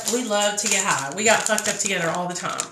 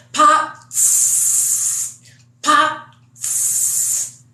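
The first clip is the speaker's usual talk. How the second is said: unvoiced hissing pops and psst; tire stabbing noises; 6 semitones higher, 4 dB louder, much slower than usual. Slashing the truck tires, hissed pop noises. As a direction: mimic a knife popping tires, hissed. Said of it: "She said".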